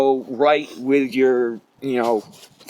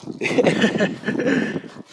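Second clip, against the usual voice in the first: light unvoiced chuckle; a short airy laugh; 8 semitones higher than usual. Real, not acted. Laugh after a self-deprecating joke.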